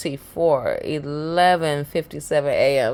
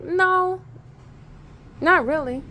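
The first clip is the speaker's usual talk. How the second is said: imitated casual answer; 12 semitones higher than usual.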